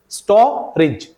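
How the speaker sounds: storage split into two pieces, sto - rij; syllable by syllable. Shown as a demonstration. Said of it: "ये रे बन जाएगा री". (storage को तोड़कर, "स्टो - रिज")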